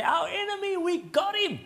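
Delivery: very high, put-on cry of capture; gloating captors. Philistines crowing as they capture David, high put-on voice. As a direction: call it out in a high mock-triumphant voice. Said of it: "the Philistines capture him and say".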